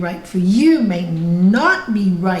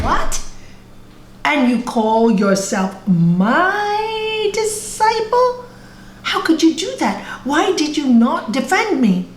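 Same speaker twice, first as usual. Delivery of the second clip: indignant disbelief; 7 semitones higher than usual. Acted. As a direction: rise high in acted outrage, stretching the reproach. Guru acted as outraged at disciple.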